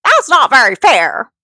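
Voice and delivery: high, loud witch complaint; funny witch voice. Shown as a demonstration. Mother doing the witch's line her kids love, funny witch voice. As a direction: protest it in a hammed-up high witch voice. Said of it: "it's just such a funny voice".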